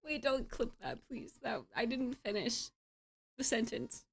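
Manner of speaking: soft, sheepish pleading; small plea. Real, not acted. Begging chat not to clip it, small soft plea.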